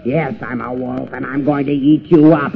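ordinary talk

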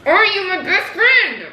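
high, slow, intense demand; sudden pushy high pitch; mood swings. Acted.

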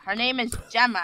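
normal talking voice

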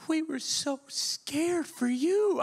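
put-on high, slow, worried old woman; fretful old lady. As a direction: stretch it out like an anxious grandmother. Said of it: "this lady, she's like".

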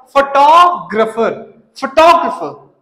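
फोटोग्राफर as "fuh-TOG-ruh-fer", slow, then joined; correct photographer pronunciation. Shown as a demonstration. Teacher drilling students, फोटोग्राफर as "fuh-TOG-ruh-fer". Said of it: "फोटो नहीं आ".

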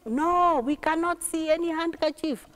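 lightly acted passengers' voice; passengers answering back. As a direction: call the answer back down the carriage. Passengers call back to the boy.

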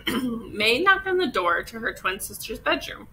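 ordinary delivery, nothing marked

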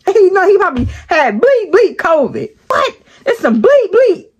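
high, fast, exasperated swearing; ranting without a pause for breath; set off, cussing. Retold or imitated. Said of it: "Any little thing will set him off".

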